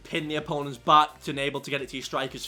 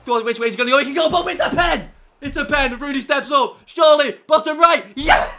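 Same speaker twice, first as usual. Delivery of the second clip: louder, higher commentator calling the penalty; excited commentary; 8 semitones higher, 9 dB louder than usual. Pretend. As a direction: build it up to the goal.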